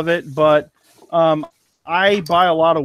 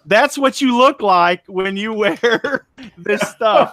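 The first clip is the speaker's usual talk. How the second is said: laughing; 5 semitones higher, slower than usual. Real, not acted. Laughs at own old photo.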